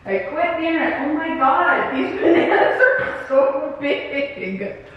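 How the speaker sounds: helpless giggles breaking up the words; laughing. Real, not acted. Creator gives up after her slip, laughing hard. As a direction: try to talk while cracking up.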